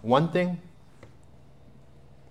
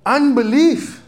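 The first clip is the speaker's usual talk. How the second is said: exclaimed; 11 semitones higher, 7 dB louder, slower than usual. Acted. Preacher exclaims key word.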